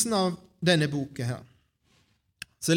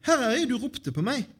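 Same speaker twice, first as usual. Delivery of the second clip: put-on high-pitched young Samuel reporting in; eager boy answering; 9 semitones higher, 3 dB louder than usual. A narrator's reading. Boy Samuel runs in to Eli, eager reply. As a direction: pitch it up like a boy who was called.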